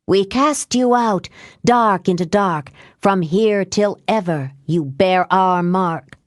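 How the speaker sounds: solemn, forceful banishing chant; ritual incantation. A narrator's reading. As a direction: cast the spell with measured, ringing command. Witches chanting a banishing spell.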